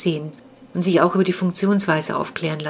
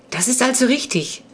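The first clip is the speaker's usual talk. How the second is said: higher, bright with delight; pleased; 6 semitones higher than usual. A narrator's reading. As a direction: glad to be proven right. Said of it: "sagte Clara erfreut".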